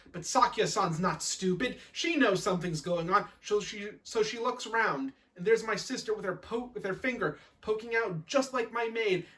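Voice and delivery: ordinary delivery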